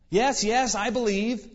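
high-pitched, hurried-sounding assurance; desperately eager. Acted. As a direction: play a stranded man eagerly agreeing. Stranded man answers the voice eagerly.

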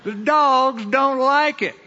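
high, drawn-out Southern holler; shouted across crowd. Acted. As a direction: holler it slowly like a Southern salesman. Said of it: "shouted across the crowd saying".

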